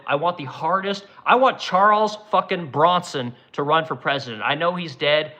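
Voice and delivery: ordinary delivery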